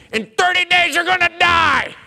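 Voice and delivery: high, loud mock prophet's pronouncement; doom prophecy. Jonah warning Nineveh of its death, loud mock doom. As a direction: boom out a gleeful prophet of doom. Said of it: "this is what he tells him".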